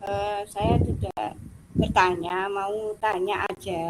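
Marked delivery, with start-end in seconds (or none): none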